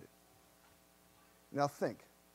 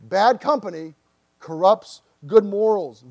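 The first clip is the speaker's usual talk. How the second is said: loud, slow and weighty; booming verse quote, each word landed; emphatic scripture quote; 10 dB louder, slower than usual. Retold or imitated. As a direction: proclaim the verse to the room. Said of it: "So Paul says".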